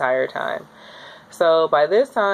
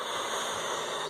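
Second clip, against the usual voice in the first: unvoiced breath out; 14 dB quieter than usual. Breathing through contraction.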